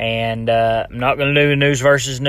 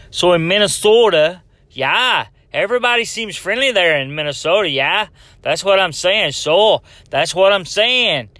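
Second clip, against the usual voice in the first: higher-pitched, hammed-up Minnesota accent; bad Minnesota accent; 6 semitones higher than usual. Podcaster mimicking friendly Minnesotans, exaggerated Minnesota accent. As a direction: do a horrible Minnesotan with a stray New York Italian twang. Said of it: "that's a horrible accent".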